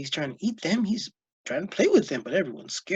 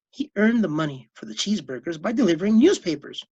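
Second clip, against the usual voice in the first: ordinary delivery, nothing marked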